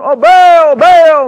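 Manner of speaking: high, loud wail to a god; drawn-out pleading holler; crying out. Acted. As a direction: imitate frantic worshippers calling their idol. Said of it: "they're crying it out".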